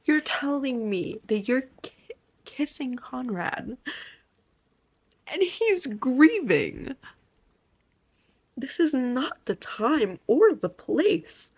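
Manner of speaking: outraged disbelief. Real, not acted.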